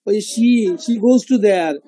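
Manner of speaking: she said slowly with an sh sound; English she. Shown as a demonstration. Teacher contrasts English she with Arabic sin, slowly. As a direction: draw out she, she goes to there slowly. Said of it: "আর আমরা সি না".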